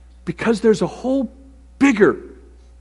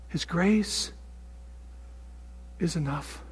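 grace repeated, softer each time down to a whisper; fading to whisper; 18 dB quieter, slower than usual. Real, not acted. Preacher repeating God's promise, fading into a whisper. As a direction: let it fade to a breath.

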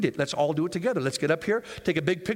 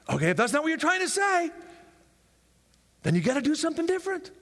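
usual speaking voice

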